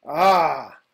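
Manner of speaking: a loud, satisfied exhale of relief; relieved loud ah after blowing nose. Real, not acted. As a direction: let out a big relieved ah as the nose clears. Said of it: "let me blow my nose".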